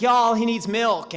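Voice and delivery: high, loud, drawn-out Texas drawl; Texas accent. Acted. Texan girlfriend announcing what the sick boy needs, Texas accent. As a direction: play a Texan girl calling out in a slow twang. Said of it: "the accent's kinda throwing you off".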